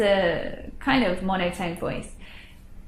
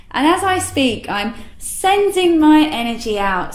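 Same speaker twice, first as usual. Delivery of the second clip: louder, energy sent outward; upbeat and confident; buoyant tone; 5 semitones higher, 6 dB louder than usual. Shown as a demonstration. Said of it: "compare it to the buoyant tone".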